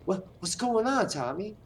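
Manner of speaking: high, breathless Boston voice; Mark Wahlberg. Acted. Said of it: "You gotta sound like Mark Wahlberg, like, out of breath all the time".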